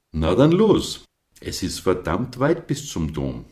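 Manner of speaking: high, loud push to get going; urging Flori. A narrator's reading. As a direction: spur the big one on. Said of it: "trieb Flori den Großen an".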